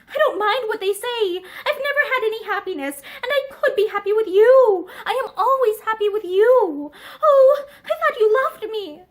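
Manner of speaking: high, loud, rising feverish plea. Acted. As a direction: pour it out in a rush. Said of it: "she broke in feverishly".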